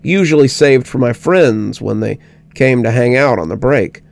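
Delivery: ordinary delivery, nothing marked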